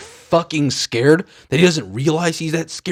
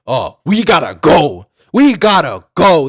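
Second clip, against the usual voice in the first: loud, rising urgent exclamation; mock panic; 3 semitones higher, 7 dB louder than usual. Frightened group deciding to escape, loud mock panic. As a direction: act out a hammed-up urge to flee. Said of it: "they're so fucking scared".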